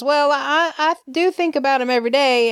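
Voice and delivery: playing Clyde with a mild Alabama twang; Alabama accent. Clyde on the phone to Kate, in a mild Alabama accent. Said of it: "let my Alabama hang out".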